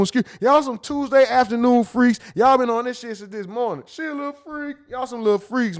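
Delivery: high, loud, scolding the chat; loud mock outrage. Real, not acted. Streamer scolding chat about Tuesday freaks, loud mock outrage.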